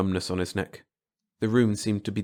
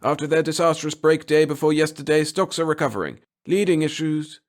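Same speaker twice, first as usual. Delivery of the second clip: put-on old-time radio newsreader, high and loud; gritty radio voice; 7 semitones higher, 4 dB louder than usual. A narrator's reading. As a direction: announce it through a tinny trumpet speaker. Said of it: "croaked a gritty voice".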